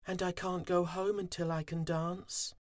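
stuck far from the hive; little bee despair; Fuzzy pleading. A narrator's reading. Fuzzy repeats his plea.

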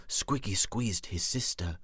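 ordinary delivery, nothing marked

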